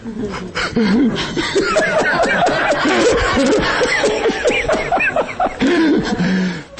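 long high laugh after the punchline; real laughter after the joke. Real, not acted. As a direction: let a big laugh roll on.

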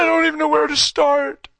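high-pitched imitation of a tearful son; crying son. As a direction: voice the boy's helpless crying up high. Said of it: "He's just crying".

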